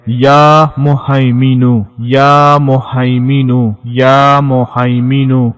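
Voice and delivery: slow, drawn-out recitation of یا مہیمینو, each call falling at the end. Shown as a demonstration. Preacher shows viewers how to recite the name, slow drawn-out chant. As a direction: recite the divine name slowly, as a model to copy. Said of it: "صرف ایک مرتبہ اللہ پاک کا یہ اسم مبارک پڑھ لینا ہے".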